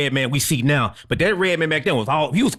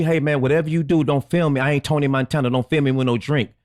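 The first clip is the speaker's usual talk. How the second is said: ordinary delivery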